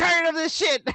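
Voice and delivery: high-pitched mock rant; hammed-up exasperation; mock fed-up outburst. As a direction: play someone quitting, high and exasperated. Co-host joins acting out the quitting.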